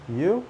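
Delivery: "You?" swept upward, high; questioning intonation. Shown as a demonstration. Teacher showing the short reply question, "You?" rising high. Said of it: "the intonation is important".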